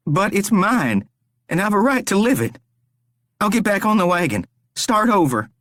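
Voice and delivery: high, desperate begging; pleading captive Rory. A narrator's reading. Rory begs the stranger to spare him, desperate.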